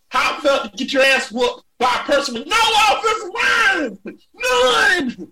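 rubbing the loss in, half shouting; mocking yell. Real, not acted. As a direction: hammer him with a mock wail. Heated comeback, cry-shout.

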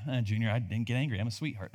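low, soft, mumbled objection; mock innocent listener. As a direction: play a meek churchgoer protesting quietly. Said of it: "Maybe you're like".

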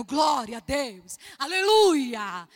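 loud, drawn-out exclamation of praise; jubilant praise. Retold or imitated. Missionary rejoicing at her pastor's permission, jubilant. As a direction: stretch each word out in loud jubilation.

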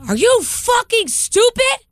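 high, drawn-out mock yell; imitated angry scream. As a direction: act out an enraged driver, stretching each word. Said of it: "screaming at the top of his lungs".

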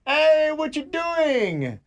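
high, drawn-out holler; imitated uncle's yell. Uncle rousing the sleeping house on Christmas morning, yelling. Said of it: "he's yelling out loud".

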